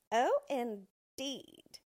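slow, drawn-out scornful oh indeed; disdainful. Acted. Dwarf scorning the queen's offer, slow disdain. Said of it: "said he disdainfully".

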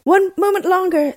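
very high anxious plea; high-pitched begging; pleading husband. A narrator's reading. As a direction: stall for time in a high voice. Husband stalls Bluebeard once more.

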